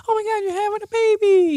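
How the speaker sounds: very high panicked mom voice; freaking-out mom. Retold or imitated. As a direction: squeal it like a frantic mother. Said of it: "freaking out".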